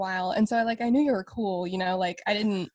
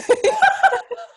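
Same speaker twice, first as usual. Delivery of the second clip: high burst of laughter; 7 dB louder than usual. Real, not acted. Embarrassed laugh after confession.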